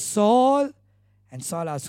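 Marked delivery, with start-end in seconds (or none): 0.0-0.7 calling the name out loud and high; Jesus calls Saul's name loudly. Retold or imitated. Jesus calling out to Saul, loud and high. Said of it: "in a very loud voice".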